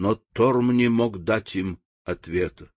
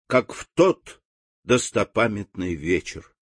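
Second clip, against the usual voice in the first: ordinary delivery, nothing marked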